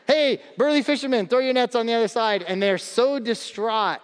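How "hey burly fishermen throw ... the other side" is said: higher, louder hail across water; Jesus calling to fishermen in a boat. Retold or imitated. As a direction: call out to the boat.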